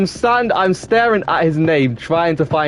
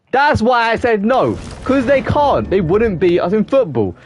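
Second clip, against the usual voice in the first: heated protest; 7 semitones higher than usual. Real, not acted. Arguing football with chat.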